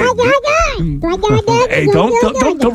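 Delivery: high squeaky chipmunk trash talk; chipmunk fighter voice. Retold or imitated. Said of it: "It's like, uh, Chipmunk Conor McGregor".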